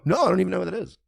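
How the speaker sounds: high-pitched exasperated denial; emphatic denial. Acted. Streamer showing how simple the answer is, high exasperated denial. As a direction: toss it off high, as if it were obvious. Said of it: "that's all you have to do is go".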